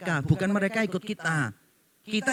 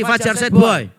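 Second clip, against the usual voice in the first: put-on "Fajar Sad Boy" belted out louder, as if calling to the crowd; louder mock call-out; 9 dB louder than usual. Shown as a demonstration. Preacher teasing the congregation about slang, in a louder mock call-out. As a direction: shout the name out to the congregation. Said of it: "Wajah-wajahnya ya loh".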